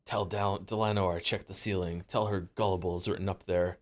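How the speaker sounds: low, hushed noir detective voice. Shown as a demonstration. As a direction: murmur it like a film noir detective. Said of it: "Nor voice".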